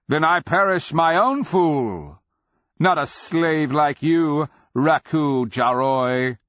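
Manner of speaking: loud, high defiant cry, slowing; cried out. A narrator's reading. Nonman taunting the beast, crying out. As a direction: cry it out, drawing out the final taunt. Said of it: "the Nonman cried".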